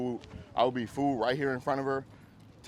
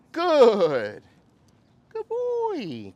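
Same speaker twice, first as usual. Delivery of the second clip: high, louder praise for the dog; 13 semitones higher, 10 dB louder, much slower than usual. Real, not acted. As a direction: lift the voice high to reward. Trainer praising dog during drill.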